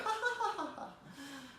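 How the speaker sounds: high, theatrical burst of laughter; high staged laugh. Pretend. When the sky parts, a high theatrical laugh. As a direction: laugh grandly as if on cue. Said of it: "there will be laughter".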